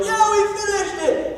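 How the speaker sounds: high, thrilled cheer with a laugh; hammed-up youthful whoop of triumph; mock cheer. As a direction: cheer it like an excited kid who knows no better. Young people cheer the foundation.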